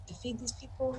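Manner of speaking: very soft in-character whisper. Acted. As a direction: whisper it as a hushed question. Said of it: "I whisper".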